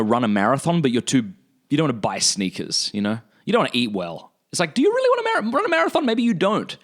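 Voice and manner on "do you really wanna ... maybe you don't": very high, quick incredulous voice; mock doubting. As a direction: squeak out a teasing doubt. Mocks a half-hearted would-be runner.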